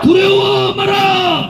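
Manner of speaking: slow, drawn-out slogan shouts; roaring rallying speech. Real, not acted. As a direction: chant each slogan for the crowd to echo. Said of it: "큰 소리로 제 구호를 따라해 주시기 바랍니다".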